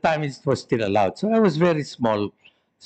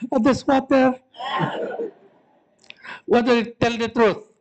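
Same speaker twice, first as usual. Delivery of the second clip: put-on childish excuse; 9 semitones higher than usual. Boy caught almost crying.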